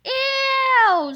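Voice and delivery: high, loud grossed-out ew; loud high ew of disgust. A narrator's reading. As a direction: recoil in disgust. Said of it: "said George".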